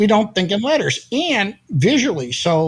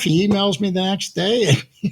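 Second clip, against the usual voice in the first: ordinary talk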